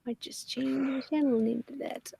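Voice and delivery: barely voiced, musing whisper; whispered aside. Real, not acted. Streamer musing on renaming the channel, whispered aside. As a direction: whisper to yourself. Muses aside while typing.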